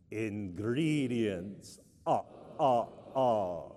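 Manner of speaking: low, very slow vampire count with chopped laugh. Shown as a demonstration. Leading the congregation together in a count-like voice. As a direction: stretch the word, then space out the laugh. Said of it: "say it in a count-like voice".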